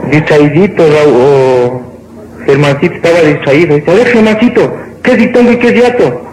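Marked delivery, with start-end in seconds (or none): 3.9-6.2 high teacher voice, quickening at the end; acted schoolteacher. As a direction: put the distracted pupil on the spot. Teacher quizzing distracted pupil. (maestra que interroga, aguda y rápida)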